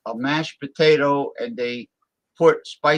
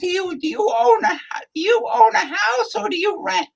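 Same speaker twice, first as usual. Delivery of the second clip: high falsetto woman's voice; squeaky high lady voice; female voice; 3 dB louder than usual. Acted. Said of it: "me do the female voice".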